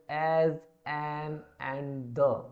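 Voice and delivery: slow reading. Shown as a demonstration. Teacher reads definition slowly aloud.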